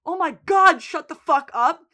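loud, high outburst of exasperation; snapping. Retold or imitated. Soldier at a funeral fed up with chanting, snapping. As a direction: act out someone snapping, voice jumping up. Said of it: "eventually he snaps".

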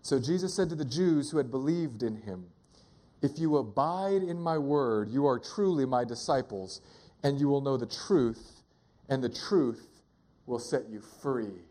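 low, measured Bible reading; solemn verse with pauses before the close; scripture reading. Real, not acted. Reads verse one more time.

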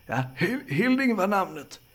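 put-on high-pitched elderly politeness; polite old man. Old man giving his name and declining help, polite. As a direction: introduce yourself in a high, old voice.

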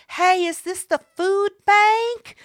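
high, drawn-out stranger's question; mimicked older lady. Mimicking a stranger asking from her car window, in a high, slow older-lady voice.

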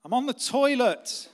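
high, loud, drawn-out holler; acted harried dad calling out; exasperated shout back. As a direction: yell it through the bathroom door.

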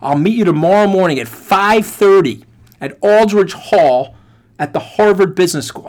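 put-on Tennessee accent. Recruiter setting a meeting, loud imitated Tennessee accent. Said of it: "I can't do the accent that well".

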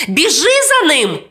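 mom's high-pitched call; mother calling out. A narrator's reading. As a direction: call urgently to your child. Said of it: "гукнула мама".

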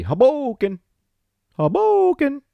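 very high, loud shout; celebratory holler. Pretend. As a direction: holler it like a hyped-up announcer, stretching the middle. Hollers the number one city.

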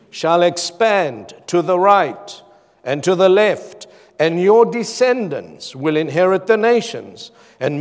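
loud, emphatic Bible reading; forceful preacher's declamation; scripture proclamation. Acted. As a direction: proclaim the promise, hitting key words hard. Reads scripture aloud emphatically.

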